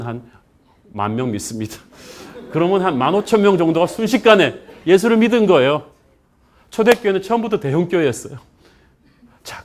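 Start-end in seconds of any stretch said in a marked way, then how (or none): 2.5-8.4 high, loud, excited pulpit storytelling; raised, ringing sermon delivery; full-force preaching. Real, not acted. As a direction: proclaim the miracle to the whole congregation.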